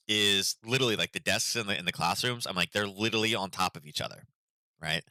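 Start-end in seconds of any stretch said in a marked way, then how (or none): none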